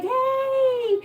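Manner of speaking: very high, bright hey; excited dog greeting. Retold or imitated. Voicing the happy dog.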